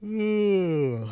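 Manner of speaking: mid-yawn. Real, not acted. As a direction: yawn through the word. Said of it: "I really don't mean to be yawning this much".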